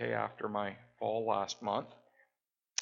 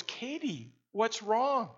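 concerned dad; 10 semitones higher, slower than usual. Retold or imitated. Dad asks his daughter.

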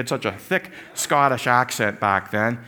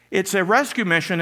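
ordinary talk

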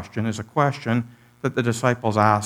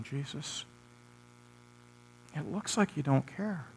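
hushed complaint; 13 dB quieter than usual. Pretend. Hushed lament to Jesus.

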